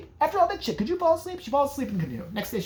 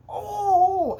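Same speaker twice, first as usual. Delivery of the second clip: pained cry; 13 semitones higher, 4 dB louder than usual. Retold or imitated. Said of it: "She's like".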